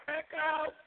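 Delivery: digital echo. Real, not acted. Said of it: "I hear echo".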